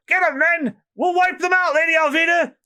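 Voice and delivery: rally the men to charge; battle cry. Acted.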